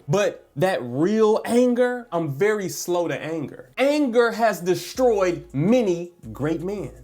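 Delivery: ordinary delivery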